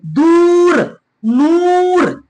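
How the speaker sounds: दूर and नूर with long oo, held high; drawn-out vowel. Shown as a demonstration. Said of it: "ये खींचा हुआ वाव है".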